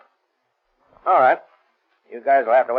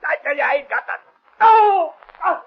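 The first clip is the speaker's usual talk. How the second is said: pleading rising into a much higher, loud scream of no; terrified cry; 6 dB louder than usual. Acted. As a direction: break into a pained cry. Blackie tortured, cries out.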